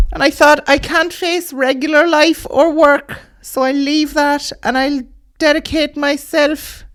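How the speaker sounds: high, slow, heartbroken eccentric lady; pigeon lady voice. Acted. Comic telling the audience, as a high batty pigeon lady. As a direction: pitch it way up and drag it out like a batty bird woman.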